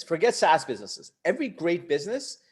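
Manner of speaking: usual speaking voice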